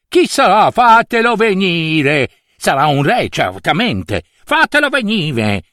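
high, excited summons; eager king. Acted. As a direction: call for the stranger, stretching the first order.

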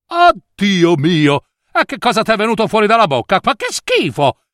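high, shocked exclamation; horrified disgust. Acted. Mother seeing vipers leave Cecilia's mouth, horrified. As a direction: recoil from something revolting.

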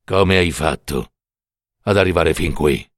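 very low, measured figure of Death; Death's voice. Acted. Death questions the mother who got there first, deep and grave. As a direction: speak as Death, deep and unhurried.

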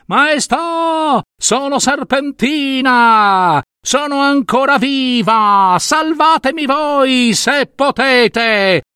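high, slow, long-drawn far-off call; faraway plea in a high, drawn-out wail; mysterious distant voice. Acted. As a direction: call from very far away, stretching each word. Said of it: "una voce misteriosa, lontana, lontana".

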